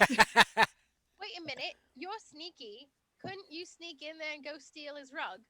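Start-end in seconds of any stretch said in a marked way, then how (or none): 0.0-1.8 slow, loud wait a minute; drawn-out loud realization; sudden realization. Real, not acted. Sudden idea.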